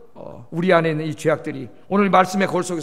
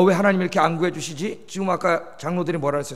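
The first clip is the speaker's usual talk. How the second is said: normal talking voice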